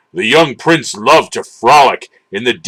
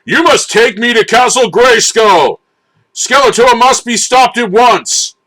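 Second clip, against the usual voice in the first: heroic command; 10 semitones higher than usual. A narrator's reading. He-Man commands Battle Cat.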